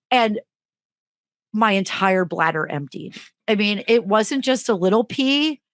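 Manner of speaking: ordinary talk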